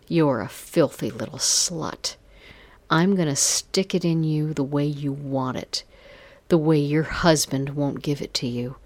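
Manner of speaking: ordinary delivery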